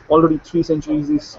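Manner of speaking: ordinary talk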